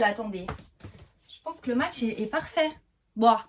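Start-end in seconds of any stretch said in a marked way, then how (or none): none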